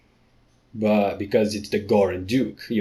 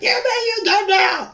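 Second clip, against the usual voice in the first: very high squeal of surprise; shrill high shout; 4 dB louder than usual. Pretend. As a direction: squeak it out in shock. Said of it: "Shouted her".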